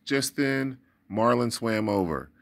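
usual speaking voice

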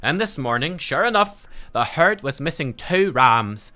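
dwarf quest-giver voice. Acted. Dwarf rancher asks a traveller to slay the beast, dramatic accented voice. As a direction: read it as a dwarf pleading for a hero. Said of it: "I'll do a Russian accent for the next dwarf we talk to".

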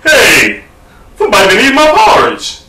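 put-out bear calling out, pitch jumping up; Papa Bear. Acted. Father bear finding his porridge eaten, indignant call. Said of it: "So Papa Bear's like".

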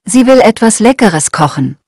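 ordinary talk, nothing marked

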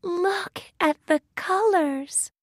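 high-pitched voice full of wonder; wondering cry. A narrator's reading. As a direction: marvel in a high little heroine voice. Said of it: "cries Thumbelina".

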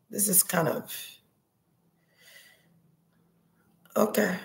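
overcome, fading. Real, not acted. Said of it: "I'm getting emotional".

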